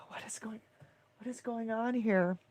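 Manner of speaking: real whisper, hushed and overwhelmed; stunned whisper. Real, not acted. Host flooded with chats, stunned whisper. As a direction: whisper it in disbelief, almost no voice.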